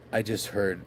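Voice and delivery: usual speaking voice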